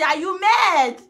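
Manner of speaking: loud, high mocking Vera; hint of a loud taunting Vera. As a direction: fling it like Vera. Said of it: "Vera go say".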